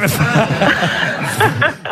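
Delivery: real amused laugh; laughter. Real, not acted. Host amused by teasing of caller, laughter. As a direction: burst out laughing.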